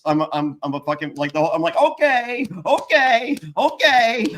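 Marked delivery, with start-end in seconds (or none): 1.8-4.2 high, loud nervous okays; repeated okays while freaking out in the chair; panicky. Retold or imitated. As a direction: psych yourself up in a panic.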